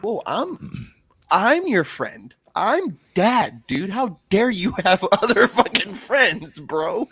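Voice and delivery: hammed-up jealous dad. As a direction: play a possessive, betrayed father.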